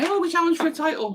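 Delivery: high, sneering mock of a smug rival fan; mocking Arsenal fan. Streamer mocking an Arsenal fan's boast, high sneering mimicry. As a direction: squeak it out like a deluded fan bragging. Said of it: "you say".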